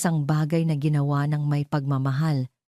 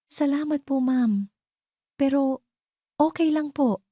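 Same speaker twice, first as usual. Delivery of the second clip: put-on polite young maid; 10 semitones higher than usual. A narrator's reading. Ara the nanny politely declines her boss's help. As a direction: answer your employer meekly and politely. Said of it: "Magalang na sagot ni Ara".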